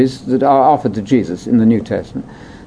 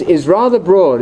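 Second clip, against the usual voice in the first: ordinary talk, nothing marked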